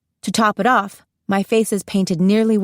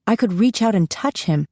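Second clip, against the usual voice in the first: plain reading voice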